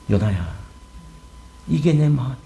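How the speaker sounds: low, hushed call by name; subdued, heartfelt divine voice; tender God. Acted. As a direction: speak softly and low, as God confiding in the prophet. God tenderly addresses Jonah.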